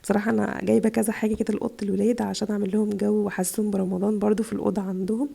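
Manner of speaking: ordinary talk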